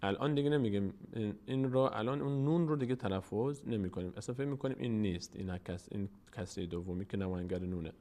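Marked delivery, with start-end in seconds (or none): none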